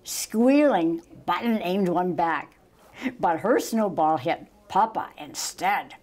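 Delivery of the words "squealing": ordinary delivery, nothing marked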